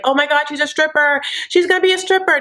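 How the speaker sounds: high-pitched gleeful retold gasping; mock excited guessing. Viewer guessing the secret, high-pitched excitement retold. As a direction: blurt the theory in a high voice. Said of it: "I was like".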